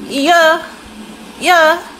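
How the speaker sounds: high, loud, short squeak; celebrity impression. Answering a fan as [name], high loud squeak.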